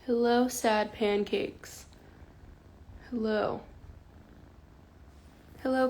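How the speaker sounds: usual speaking voice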